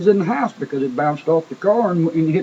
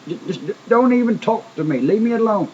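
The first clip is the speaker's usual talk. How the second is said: higher, exasperated mock yell; retold angry snap, pitched up; angry brush-off; 6 semitones higher than usual. Young man angrily waves off the dad, pitched-up mock yell. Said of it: "cuss Dad and shake his fist and say".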